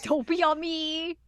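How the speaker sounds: lightly put-on alarmed voice; mock plea. Echoing the acted panicked plea.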